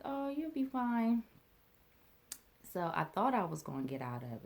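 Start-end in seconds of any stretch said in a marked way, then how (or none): 0.0-1.2 high, louder, drawn-out brush-off; slow, singsong mock reassurance; imitated breezy reassurance. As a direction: wave the worry away, voice pitched up. Aunt's retold breezy reassurance.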